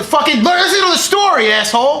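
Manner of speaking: loud, exasperated outburst; fed-up shout at the other hosts; annoyed yell. Real, not acted. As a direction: bark it at the guys who keep interrupting. Frustrated teller yelling at hosts.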